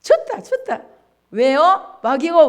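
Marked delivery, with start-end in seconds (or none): 0.0-0.8 devil gloating. Acted. Preacher mocking the devil's glee before the congregation. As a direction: crow like a delighted devil. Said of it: "마귀가 좋아하는 거예요".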